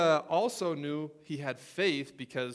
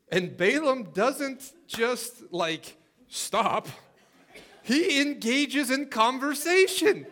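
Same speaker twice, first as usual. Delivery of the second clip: much higher, louder and slower with rising energy; excited preaching; 9 semitones higher, 8 dB louder, much slower than usual. Real, not acted. Preacher telling the congregation about Balaam, excited and loud. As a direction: build the story up, stretching every word.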